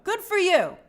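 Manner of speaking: upbeat praise for the hard worker; cheering. Real, not acted. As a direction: cheer them on brightly. Praising viewer's extra work.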